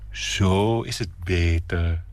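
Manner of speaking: slow, serene, calm. Acted. Nina arranging flowers, slow and serene. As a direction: say it with unruffled calm. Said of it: "zegt ze sereen".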